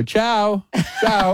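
ciao sung out high and sing-song; a showy, breezy ciao, ciao on leaving; mock-Italian ciao, ciao flung out high. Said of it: "you go".

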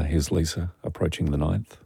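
low, hushed golf commentary; golf commentator. Acted. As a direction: murmur it like a TV golf announcer. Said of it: "You need to talk like a golf commentator".

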